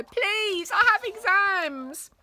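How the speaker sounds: put-on high, slow begging child; pleading boy. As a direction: beg through the cupboard door.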